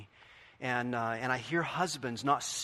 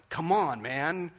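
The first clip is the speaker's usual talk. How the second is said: teasing challenge; 4 semitones higher, 6 dB louder, much slower than usual. Retold or imitated. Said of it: "you can go to your husband like".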